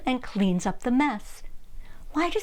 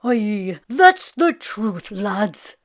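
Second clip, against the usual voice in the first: lazy Irishman; 2 semitones lower, 4 dB louder, much slower than usual. Acted. Jamie agreeing with friends.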